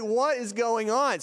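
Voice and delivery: high, slow, emphatic disbelief; retold bewildered alarm. Teller reliving chase in disbelief.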